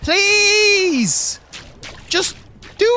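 high, loud desperate plea; screaming please at the screen. Real, not acted. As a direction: beg the climber at the top of your voice. Begging the game character.

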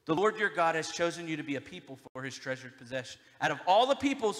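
loud, fast scripture read. Acted. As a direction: read the promise with force. Reading Deuteronomy aloud to congregation.

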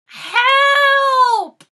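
high, loud panicked shout; terrified yell at King Kong; cry for help. Acted. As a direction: scream for help from the top. Empire State Building attacked by King Kong.

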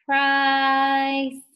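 price drawn out very slowly; stretched slow. Shown as a demonstration. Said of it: "really slowly".

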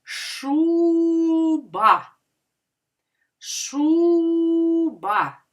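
high, very long ШУ in Шуба; stress on first syllable. Shown as a demonstration. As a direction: call out ШУ long and high, then a short ба in Шуба. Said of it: "А який буде склад наголошений?".

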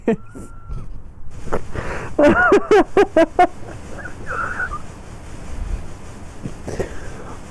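high silent airy laugh; real breathless giggling; wheezing laughter. Real, not acted. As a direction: wheeze without sound, doubled over. Long silent laughing fit.

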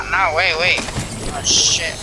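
usual speaking voice

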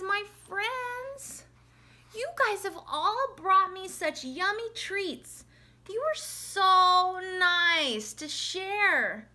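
acted, heartfelt high bear voice; touched storybook bear. A narrator's reading. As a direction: address dear friends with drawn-out feeling. Bear addresses friends, sighing, moved.

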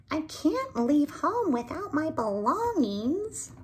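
high, loud insistent little bird; young bird Binkle. Acted. Binkle tells Maybelle he must take his things, high and insistent. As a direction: insist like a worried child.

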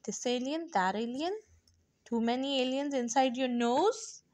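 usual speaking voice

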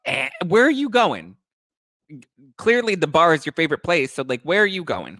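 high loud sassy challenge. Retold or imitated. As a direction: throw it back with sass. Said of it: "I'm like".

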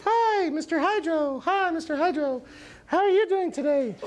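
very high falsetto puppet voice; squeaky storybook character. Acted. Puppet-like friend Mister Goop greets Hydro.

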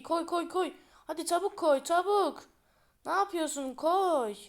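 high, loud urging; urgent. Real, not acted. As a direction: hurry them up loudly. Urging a teammate.

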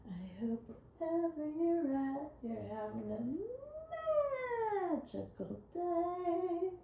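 soft sing-song. Acted. Soft dreamy greeting.

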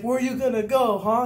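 high, loud mocking yell; taunting shout. Instructors jeering at a recruit leaving: high taunting shout. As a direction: jeer at him as he heads for the door.

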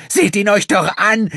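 very high, loud, slow shriek near breaking; shrill, cracking scream. Acted. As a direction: voice about to crack with hate and pain. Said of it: "Ihre Stimme war schrill und drohte überzuschnappen". (schriller, überschnappender Schrei)

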